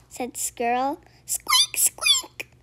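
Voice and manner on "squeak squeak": very high squeaky squeak; tiny squeaks. Acted. Baby creature squeaking on Fox's head.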